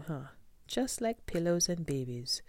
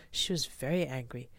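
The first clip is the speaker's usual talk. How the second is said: ordinary delivery, nothing marked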